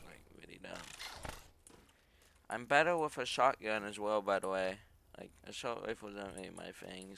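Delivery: ordinary talk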